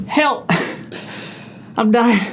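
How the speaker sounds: high-pitched yelp of distress; desperate cry for help. Real, not acted. Hot sauce in his eyes, desperate cry for help. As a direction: cry out in sudden burning pain.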